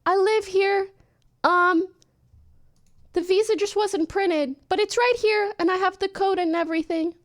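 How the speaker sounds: high, sweet explaining; overly polite high voice. Retold or imitated. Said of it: "I said".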